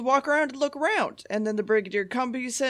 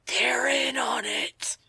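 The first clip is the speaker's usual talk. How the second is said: whisper; 3 semitones higher, slower than usual. Real, not acted. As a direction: whisper it like a secret. Conspiratorial aside.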